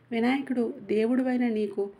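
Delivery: plain reading voice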